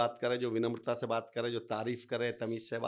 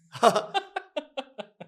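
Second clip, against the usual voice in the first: laugh; 13 semitones higher, 7 dB louder than usual. Real, not acted. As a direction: burst of high laughter. Laughs at own car analogy. (ज़ोर से हँसी)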